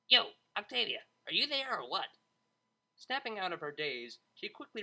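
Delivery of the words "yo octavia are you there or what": loud, high DJ voice calling down the phone. Acted. Vinyl calling Octavia on the phone, loud and impatient. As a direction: shout to get her attention.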